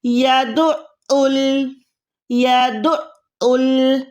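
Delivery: यदउल split by a held throat catch after "du"; slow यदउल, pressing the ain into both syllables; joined, doubled ain. Shown as a demonstration. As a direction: say "ya-du'... 'ul" for यदउल, very slowly. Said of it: "पहले दाल से मिलाना है".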